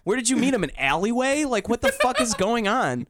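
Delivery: high, slow, mocking disbelief; rubbing the absurdity in; incredulous rant.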